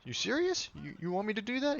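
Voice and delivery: mock disbelief in a high voice; incredulous. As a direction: squeak out a can't-believe-it question. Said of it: "I'm like".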